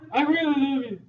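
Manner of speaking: hammed-up creepy admirer; creep. Shown as a demonstration. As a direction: lay it on like a creep. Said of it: "the third one is a creep".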